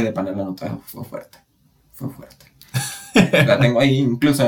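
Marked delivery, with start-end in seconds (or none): 1.9-2.5 a voiceless echo to herself; whispered echo. Real, not acted. Echoing her own point to the cohost, whispered. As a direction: whisper it under her breath.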